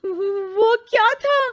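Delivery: high, startled gasp of a question; jolted by a talking TV. Acted. Startled after TV talks back. (चौंक कर पूछना)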